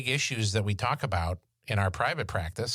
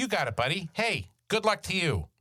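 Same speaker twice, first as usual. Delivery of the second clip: higher-pitched, falsely cheery encouragement; mock send-off; 8 semitones higher than usual. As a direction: wish them luck with hollow pep. Mock send-off to burdened employees.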